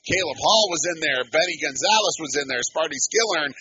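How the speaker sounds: sportscaster crediting a big tackle; live play-by-play. Real, not acted. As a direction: punch out the tacklers' names.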